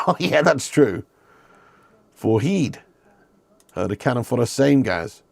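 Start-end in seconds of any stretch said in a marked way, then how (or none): 2.1-2.8 "Foreheed" said as fore-HEED, ending on a long ee; mock pronunciation. Shown as a demonstration. Streamer repeating a chatter's joke word as "Foreheed", with a long ee. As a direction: stretch "Foreheed" into fore-heed with a questioning lift. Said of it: "That would be foreheed".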